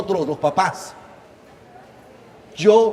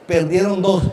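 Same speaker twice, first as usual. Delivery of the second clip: normal talking voice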